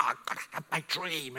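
hushed, mock-anxious muttering; anxious young person. Young people about their dream, mock anxious. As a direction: rush it out softly, imitating worry. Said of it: "they're so anxious".

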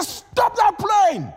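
very high, slow, loud scream. Retold or imitated. Preacher retelling yelling at airline crew to stop the plane, screaming. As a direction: scream it out long and desperate. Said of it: "I just screamed".

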